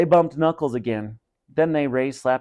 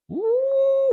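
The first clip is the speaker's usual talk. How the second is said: very high mock-frightened ooh; teacher reacting in mock fear to the threat; mock scared ooh. Real, not acted. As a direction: squeal in fake fright.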